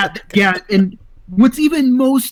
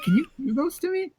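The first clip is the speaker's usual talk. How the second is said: sheepish ask; 9 semitones higher, 8 dB quieter than usual. Retold or imitated. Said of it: "I just said it impulsively".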